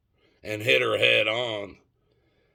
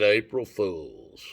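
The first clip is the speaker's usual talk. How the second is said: deadpan reveal; slower than usual. Acted. Punchline of the prank call.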